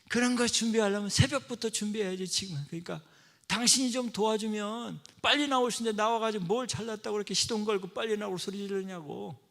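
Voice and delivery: loud, high, scolding comeback; acted wife scolding loud and high; angry retort. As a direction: snap back at the husband, indignant. Wife's angry retort in quarrel.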